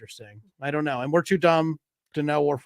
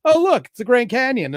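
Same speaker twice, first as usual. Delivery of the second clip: put-on casual drunk tourist; 8 semitones higher, 6 dB louder than usual. Retelling drunk Russians' quote.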